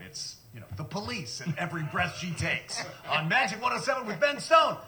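DJ announcer voice; top ten countdown. Retold or imitated. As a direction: punch it like a top forty DJ. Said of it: "I crack up the microphone".

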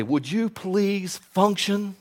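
slow earnest plea. Real, not acted. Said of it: "let me just stir you up and say".

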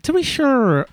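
high, loud mock Irish lilt; Irish accent. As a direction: put on a hammy Irish accent. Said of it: "I'm not gonna do the Irish accent".

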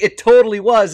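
amused emphatic agreement. Retold or imitated. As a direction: insist it totally was. Retold agreement after laughing.

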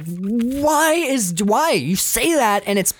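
high, outraged questioning; upset Clementine. Retold or imitated. Clementine freaking out at Joel over nice, high outraged voice. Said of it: "she turns and she's like".

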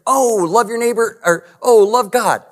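hammed-up casual news viewer; mock soundbite listener. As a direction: toss off the quote like a shallow listener. Mocking a media soundbite reaction.

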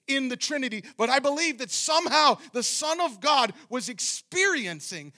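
high, very loud sermon delivery; relentless, urgent pulpit shout; impassioned preaching. Real, not acted. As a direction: preach at full force, nearly shouting. Preaches at full force.

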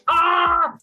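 a short frustrated cry; stressed yelp. Retold or imitated. Retelling the wife's stress, a high mock shriek. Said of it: "And then she's stressed".

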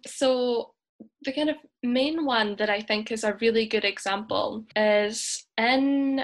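ordinary talk, nothing marked

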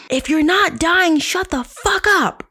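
high, loud, slow shouted scold; mock yell out the window. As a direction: yell it down at the street like a furious neighbor. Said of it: "I say".

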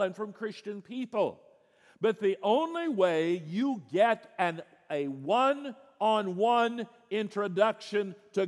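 high, loud preaching voice; fervent preaching. Acted. Preacher proclaiming Christ to the congregation, loud and fervent. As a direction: drive each point home from the pulpit.

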